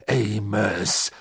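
ordinary delivery, nothing marked

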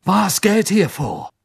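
high, drawn-out demand; agitated call. A narrator's reading. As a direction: cry out for an explanation. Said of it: "rief er".

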